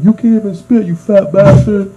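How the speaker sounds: high, loud retold jeer; mock angry taunt. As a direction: jeer it like an irate driver, drawing out the end. Retold driver taunting after missed spit.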